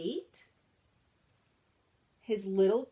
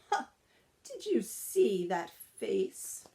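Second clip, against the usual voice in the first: chuckling; slower than usual. A narrator's reading. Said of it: "A pampered poodle chuckled".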